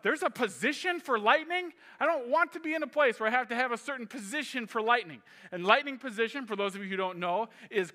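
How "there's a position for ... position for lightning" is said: high, louder disbelief, quick; alarmed and baffled at the idea; incredulous thought. Retold or imitated. Thinking to himself in the storm, incredulous and alarmed. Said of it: "I'm thinking to myself".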